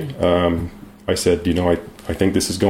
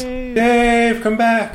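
calling out; much slower than usual. Real, not acted. Calling out to dropped host.

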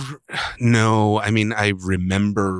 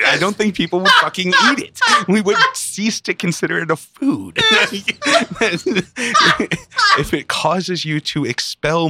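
laughing; 9 semitones higher, 4 dB louder than usual. Real, not acted. Laughing rant about zucchini.

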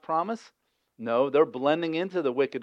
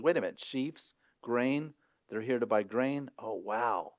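softer, thinking aloud; dawning realization; 3 semitones lower, 8 dB quieter than usual. Retold or imitated. Joseph connecting the dreams to the grain, softly to himself. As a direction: piece it together under your breath.

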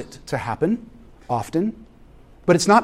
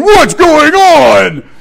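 calling out; 12 semitones higher, 11 dB louder, much slower than usual. Retold or imitated. Dad calling out to screaming kids.

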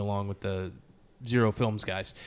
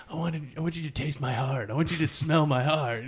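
higher-pitched mock-heartfelt plea; lightly mocking imitation, pitched up; teasing mimicry; 5 semitones higher than usual. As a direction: tease a friend by parroting his earnest words. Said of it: "Nate's always like".

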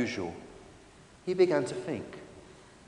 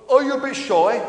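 a slightly higher, louder chummy dinosaur; lightly voiced Stefan, friendly; 7 semitones higher, 5 dB louder than usual. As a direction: coax a silent stranger, chummily. Friendly dinosaur coaxes silent stranger.

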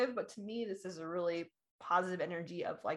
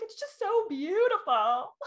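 high, louder and slowed, swept away; much higher, slow and overwhelmed; overwhelmed gushing; 11 semitones higher, 5 dB louder, slower than usual. Retold or imitated. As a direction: stretch it out, moved to tears by beauty. Crying at the mountain town.

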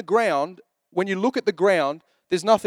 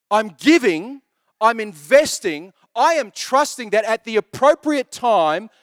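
rising, louder preaching with slowed key words; fervent preaching; 5 semitones higher, 4 dB louder than usual. Acted. Pastor preaching to the congregation, fervent and louder. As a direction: preach it with building conviction.

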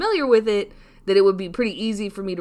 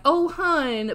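higher, lightly Southern sympathy; Southern US imitation; 7 semitones higher than usual. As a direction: coo it like a Southerner. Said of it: "in the South, people be like".

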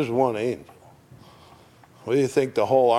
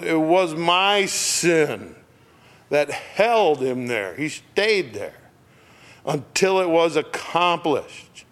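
slow, higher, emphatic lines; impassioned preaching; 6 semitones higher, slower than usual. Acted. Preacher stressing that his own sin held Jesus. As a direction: drive it home slowly.

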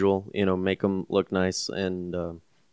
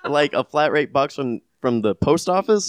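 laughing disbelief; 6 semitones higher than usual. Real, not acted. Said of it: "laughing in disbelief".

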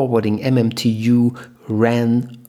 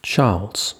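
ordinary delivery

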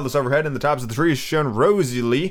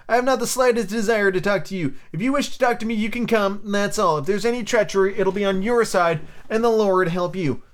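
lightly voiced captain, higher pitch; stern captain; 6 semitones higher than usual. A narrator's reading. Captain refusing friendly talk with pirate, mildly stern. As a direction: answer coolly, pitched up from narration.

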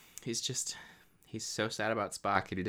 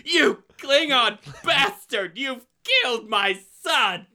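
very high, loud, slow anguished howl; Kirk rage; 12 semitones higher, 7 dB louder, slower than usual. Shown as a demonstration. Kirk howling at the Klingon for killing his son, high and slow. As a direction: stretch every word in grief and fury. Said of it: "Third time for good measure".

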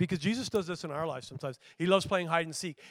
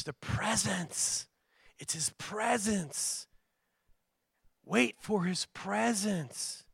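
slow emphasis; 2 semitones higher, much slower than usual. Real, not acted. Preacher stressing key point.

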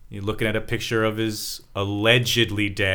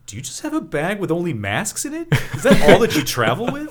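higher-pitched imitation of a baffled boy; incredulous kid; 8 semitones higher, 4 dB louder than usual. Host retelling Reggie seeing a bag of masks, incredulous. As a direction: build to a loud, high mock disbelief. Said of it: "and he's like".

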